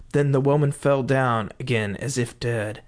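plain reading voice

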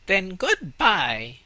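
high, slow, curt rat voice. Acted. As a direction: dismiss the tree. Rats leave.

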